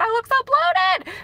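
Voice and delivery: squeaky high, slow whine about herself; quoting herself in a silly high voice; mock high voice. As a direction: pitch way up in a mock complaint. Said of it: "I'm like".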